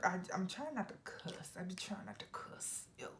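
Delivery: hushed, guilty aside; whispered aside. Real, not acted. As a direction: whisper the confession under your breath. Aside about not cursing.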